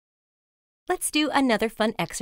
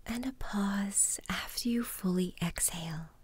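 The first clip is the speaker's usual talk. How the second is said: soft spa whisper; 5 dB quieter than usual. Acted. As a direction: whisper calmly, leading each breath. Guided mindfulness exercise in whisper.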